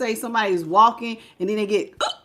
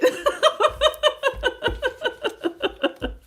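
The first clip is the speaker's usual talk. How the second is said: long, high breathy giggle; high giggling fit. Real, not acted. Still giggling at the viewer's comment, high and airy. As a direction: let the giggles run out.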